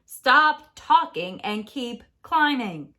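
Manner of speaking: loud, slow command; stern order. Acted. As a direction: order him on. Narrator orders Jack.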